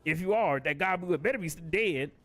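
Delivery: put-on high, loud scolding old chief. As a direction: scold the drunk like an old boss. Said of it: "Oh, some old dude".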